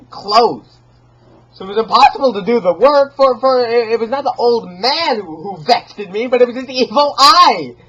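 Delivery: rushed, shrill-pitched tirade; frantic raving. Acted. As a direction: rant feverishly about the eye. Raving about the evil eye.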